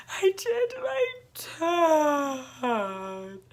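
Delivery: high, very slow drawn-out wail; sobbing. Retold or imitated. Repeating to her mom, a very slow sobbing wail. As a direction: stretch it into a sob.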